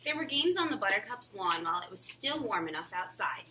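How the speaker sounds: ordinary delivery, nothing marked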